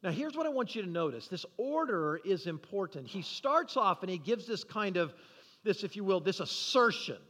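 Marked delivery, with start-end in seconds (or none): none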